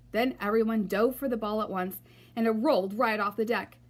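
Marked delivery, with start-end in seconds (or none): none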